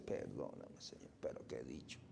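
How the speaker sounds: a hushed, low whispered apology; sheepish whisper. Pretend. Believer apologizing to the Lord, abashed whisper. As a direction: whisper it like a caught child.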